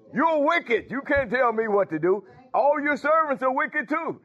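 high-pitched, defiant retort; mock accusation. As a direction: act out an indignant comeback. Acts out someone's defiant retort to reproof.

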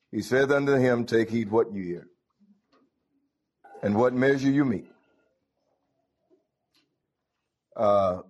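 measured, rising scripture reading. Acted. Preacher reading scripture to the congregation, measured. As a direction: read the verses out with weight. Reads scripture aloud in service.

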